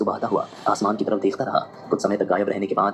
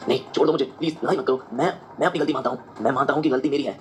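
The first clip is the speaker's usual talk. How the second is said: higher, frightened pleading; panicked begging; 5 semitones higher than usual. A narrator's reading. Akash begs the thug with a brick to spare him, pleading. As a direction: beg the thug to stop, terrified. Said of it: "घबरा गया और गिड़गिड़ाने लगा".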